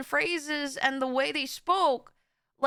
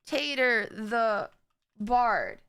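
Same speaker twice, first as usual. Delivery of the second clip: slow, word by word, "Tater" as TAY-ter; slow reading aloud; 5 semitones lower, much slower than usual. Real, not acted. Streamer looking up the name, slow careful reading. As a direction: read it out slowly while checking.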